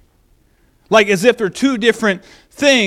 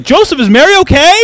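concerned visitor; 7 semitones higher, 9 dB louder, faster than usual. Retold or imitated. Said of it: "Somebody's like".

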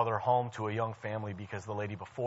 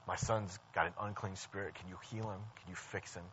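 soft, low voice for a father pleading; understated pleading, dropping away softly; retold plea; 4 semitones lower, 9 dB quieter than usual. Father pleading with Jesus for his son, soft retold plea. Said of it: "the guy is pleading with Jesus".

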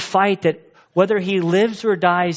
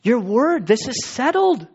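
higher, earnest declaration, slowing on shifting sands; voicing the psalmist; 7 semitones higher, slower than usual. Pastor voicing the psalmist telling the Lord, lightly raised and earnest. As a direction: speak as the psalmist, lifted and sure. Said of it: "like the psalmist says, like".

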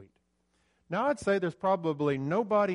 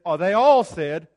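"all" hit far louder than the rest; stress on all; 3 semitones higher, 13 dB louder than usual. Shown as a demonstration. Preacher stressing that all disciples said it, loud "all". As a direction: punch "all" with full preaching force. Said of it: "The word all appears three times".

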